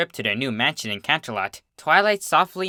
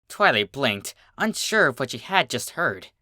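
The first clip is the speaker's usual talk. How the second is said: ordinary delivery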